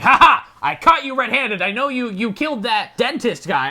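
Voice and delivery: loud triumphant "ha ha", then gloating; gloating shopkeeper. Retold or imitated. Shop owner gloating as he catches Seymour. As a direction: catch him red-handed, crowing.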